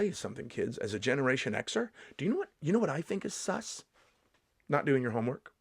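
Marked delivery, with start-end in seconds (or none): none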